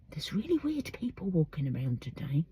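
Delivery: unvoiced, secret aside about passersby; hushed whisper to the camera. Real, not acted. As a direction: whisper it so they won't hear.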